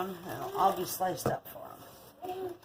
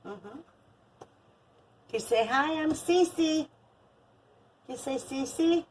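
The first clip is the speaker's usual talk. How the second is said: put-on high, sing-song baby talk; 9 semitones higher, 4 dB louder, slower than usual. Grandma cooing at her granddaughter, high baby talk. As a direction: coo it up high to a toddler.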